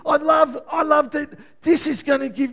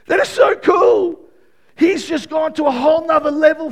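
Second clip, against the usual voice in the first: excited preaching; 6 semitones higher, 8 dB louder than usual. Real, not acted. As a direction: shout the joy out.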